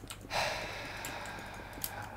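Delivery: long, soft breathy sigh; weary sigh. Real, not acted. Tired builder pausing his work, weary sigh.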